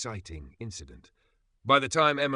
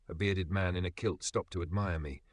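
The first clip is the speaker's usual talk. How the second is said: ordinary delivery, nothing marked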